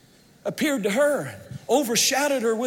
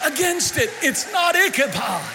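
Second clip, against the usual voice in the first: climactic preaching; 3 semitones higher than usual. Acted. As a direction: build to the climax of the sermon.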